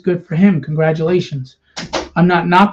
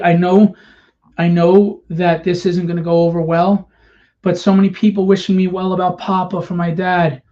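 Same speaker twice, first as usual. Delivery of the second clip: ordinary delivery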